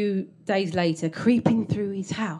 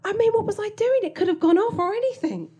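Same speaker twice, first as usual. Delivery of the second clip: ordinary delivery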